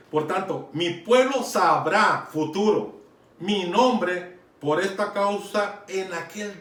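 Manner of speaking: slow, loud, weighty scripture proclamation; emphatic preaching. Acted. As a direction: drive each word of the verse home. Preacher expounds Isaiah verse emphatically.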